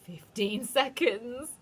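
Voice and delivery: high, slow gloating witch cry. A narrator's reading. Witch counting down to the crowd, high and gloating. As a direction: count down with cruel glee. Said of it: "cried the Grand High Witch".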